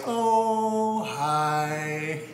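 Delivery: high, drawn-out sing-song mom hello; two-note singsong greeting; sing-songy greeting. Retold or imitated. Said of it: "greets you with a sing-songy voice".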